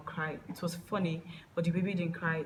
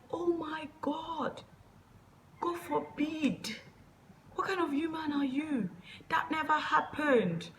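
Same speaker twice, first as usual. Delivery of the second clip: much higher, drawn-out mock outrage; shocked denial; 10 semitones higher, slower than usual. Accused man denying it before her dad, acted-out shocked protest. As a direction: stretch each protest out, high-pitched and scandalized.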